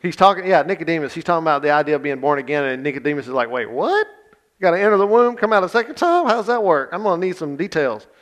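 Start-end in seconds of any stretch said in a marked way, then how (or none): 3.5-8.1 high, loud, hammed-up incredulity; baffled disbelief. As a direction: play a comically confused questioner. Acts out baffled Nicodemus questioning Jesus.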